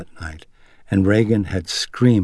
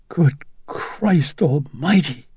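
shocked gasp; 8 semitones higher, much slower than usual. A narrator's reading. Mother sees terrified daughter.

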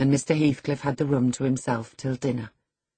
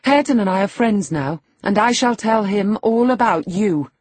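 put-on high, loud young woman; ringing, provoking defiance; defiant threat; 7 semitones higher, 6 dB louder than usual. A narrator's reading. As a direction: warn him boldly at full voice. She threatens the master.

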